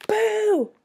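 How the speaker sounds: high, loud "Boo!"; shouted. A narrator's reading. Child clown trying to frighten two girls, shouting. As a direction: shout it to make them jump. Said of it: "I shouted".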